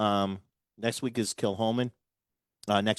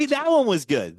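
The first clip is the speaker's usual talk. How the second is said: excited; 11 semitones higher, 7 dB louder than usual. Real, not acted. Praising the last segment.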